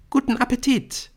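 put-on very high, girlish singsong wish; affected girl's voice. A narrator's reading. Brynn wishes Nick a good meal, high and affected. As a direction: chirp it high as a schoolgirl.

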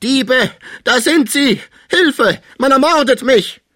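high, loud, terrified cry for help; piercing scream. A narrator's reading. Sick man waking to find thieves at his bed, piercing scream. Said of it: "schrie durchdringend auf".

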